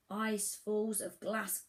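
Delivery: plain reading voice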